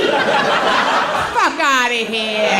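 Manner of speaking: loud, drawn-out dismissal; indignant shout. Acted. Mom scoffing at kids expecting custody fight, outraged shout. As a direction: bellow it in comic outrage, stretched out.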